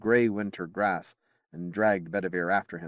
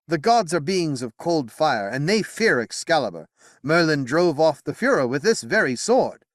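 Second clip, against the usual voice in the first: higher and louder, firm conviction; king Arthur, resolute; 7 semitones higher, 5 dB louder than usual. Acted. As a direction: speak like a young king sure of his sword. Arthur voiced in narration.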